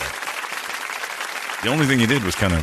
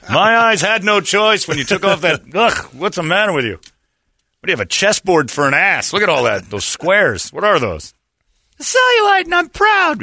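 loud high mocking heckle; mock heckler; 10 semitones higher, 5 dB louder than usual. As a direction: shout insults, high and loud. Mocking woman in thong.

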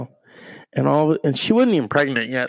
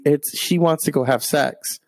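normal talking voice

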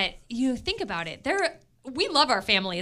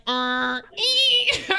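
imitated breast pump; 8 semitones higher, much slower than usual. DJ recalling pumping on air, imitated pump noise. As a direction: imitate a pump, jumping up in pitch on the middle note. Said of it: "and it was like".

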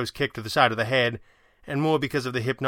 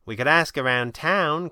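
higher, hopeful suggestion; 5 semitones higher, 4 dB louder than usual. A narrator's reading. Kit suggests to the Red Panda a search of town, hopefully. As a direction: float the idea hopefully. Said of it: "Kit said hopefully".

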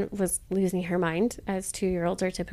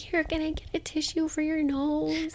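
put-on soft baby talk; 7 semitones higher, 5 dB quieter than usual. Stranger talking to toddler.

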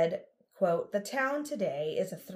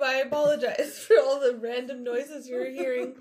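laughing; 4 semitones higher, 6 dB louder than usual. Real, not acted. Still laughing after coughing fit.

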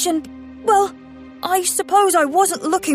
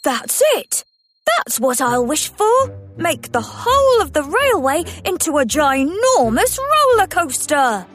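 excited; 5 semitones higher than usual. Acted. Thomas excited idea.

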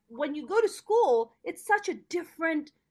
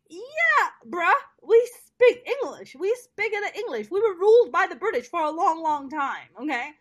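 louder and higher, sassy clapback with attitude; exasperated comeback; 4 semitones higher, 5 dB louder than usual. Retold or imitated. To her principal, a louder and higher exasperated retort. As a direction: put the principal in his place, louder and higher. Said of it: "I was like".

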